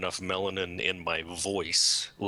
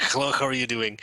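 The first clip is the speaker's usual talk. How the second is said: slightly higher, joke Mexican accent; bad Mexican accent; 4 semitones higher, 4 dB louder than usual. Shown as a demonstration. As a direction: demonstrate your fake Mexican accent. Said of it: "really bad Mexican accent".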